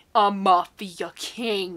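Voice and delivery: mock dramatic. Real, not acted. Mocking the trope.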